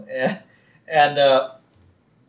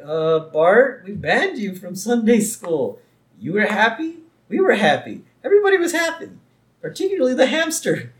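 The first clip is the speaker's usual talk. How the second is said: exasperated teacher; 9 semitones higher than usual. Retold or imitated. Exasperated teacher scolds Bart.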